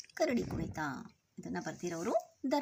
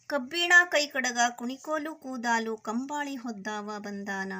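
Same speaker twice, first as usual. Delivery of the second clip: higher-pitched, rhythmic verse chant with drawn-out line endings; poem recitation; 3 semitones higher, 5 dB louder than usual. Acted. Teacher reads the bear-dance poem to children, sing-song recitation. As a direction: chant the verse, stretching each closing word.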